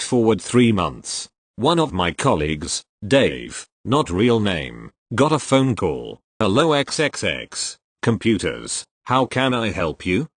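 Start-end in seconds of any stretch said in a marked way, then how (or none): none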